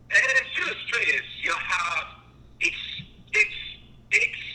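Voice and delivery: put-on halting, high French-ish hybrid accent; hybrid foreign accent. Customer asking for a film, halting hybrid foreign accent. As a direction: stumble through a muddled three-accent imitation. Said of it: "like, three accents I'm doing here".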